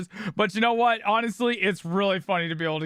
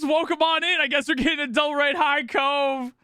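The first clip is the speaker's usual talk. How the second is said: hype man; 6 semitones higher than usual. Acted. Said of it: "a full hype man".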